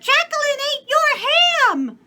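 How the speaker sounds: put-on very high, loud child voice; little girl's voice. A narrator's reading. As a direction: squeal like a small girl. Child tattles to father.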